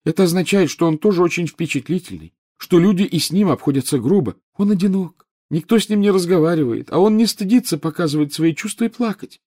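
put-on high, touchy ghost girl voice; a ghost girl defending her crush; defiant ghost girl. Myrtle voiced by narrator.

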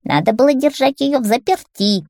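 high-pitched scolding witch; reproachful old hag, pitched high; hoarse witch. A narrator's reading. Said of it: "своим хриплым голосом".

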